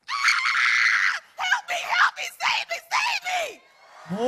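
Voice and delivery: shrieking very high, drawn out slowly at the end; terrified screaming. Shown as a demonstration. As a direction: scream for help in mock terror. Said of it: "in the most terrified".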